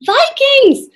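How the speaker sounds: loud, high alarm shout; crying out. A narrator's reading. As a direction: raise the alarm at the gate. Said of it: "Jack cried".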